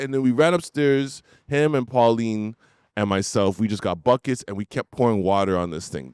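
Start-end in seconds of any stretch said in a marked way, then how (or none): none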